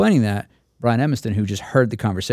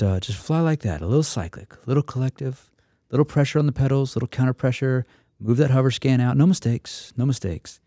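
dreamy painter voice drifting higher; Bob Ross impression; 4 semitones higher than usual. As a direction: coax the student like a painting show host. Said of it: "like Bob Ross paints".

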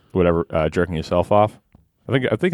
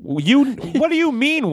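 high, slow incredulous outburst; 13 semitones higher, slower than usual. Real, not acted. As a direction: disbelief pushed way up high. Outraged at friend's pick.

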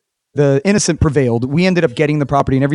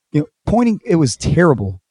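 ordinary delivery

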